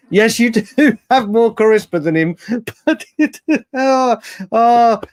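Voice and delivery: real laughter breaking through the words; helpless giggling while talking; laughing. Real, not acted. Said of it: "how much I'm laughing at that".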